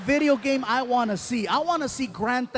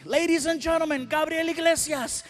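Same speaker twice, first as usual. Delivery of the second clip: high, big announcer call; high showman announce; announcer intro; 6 semitones higher than usual. Retold or imitated. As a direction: introduce the comic to the crowd.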